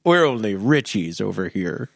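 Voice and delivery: low, slow rich snob; a lightly voiced smug tycoon; snooty rich man.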